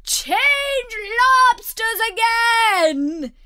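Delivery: high, drawn-out, very loud yell; slow, piercing full-throttle shout; top-of-the-voice yell. A narrator's reading. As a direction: scream it as loud as you can. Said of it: "at the top of its voice".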